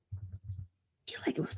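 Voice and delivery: whisper. Real, not acted. Muttering to himself while checking.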